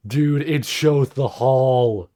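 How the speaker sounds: slow, high amazed exclaim; excited. Real, not acted. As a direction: stretch it out, thrilled. Repeats excited reaction.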